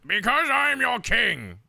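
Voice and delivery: high, loud, drawn-out mock outburst; mock-furious royal yell, stretched out; loses his cool. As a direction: bellow it like a hammed-up outraged king. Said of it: "He loses his cool and goes".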